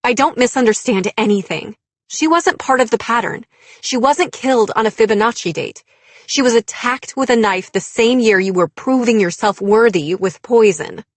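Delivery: loud, high, impassioned rebuttal; shaken anger. A narrator's reading. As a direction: argue back, voice full of emotion. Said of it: "My voice shook".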